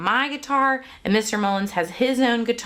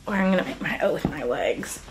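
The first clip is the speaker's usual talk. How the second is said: aside whisper; 5 semitones lower, 3 dB quieter, faster than usual. Real, not acted.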